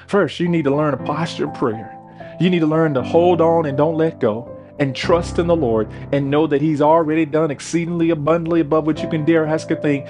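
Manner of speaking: ordinary talk, nothing marked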